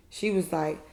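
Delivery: ordinary talk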